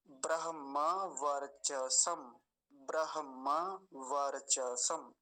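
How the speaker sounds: Brahma वर्चसम slowly, syllable by syllable; unhurried demonstration. Shown as a demonstration. Teacher repeating the whole word at ease, unhurried demonstration. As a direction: draw out bra-ham-maa var-cha-sam. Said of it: "फिर से देखिए आराम से".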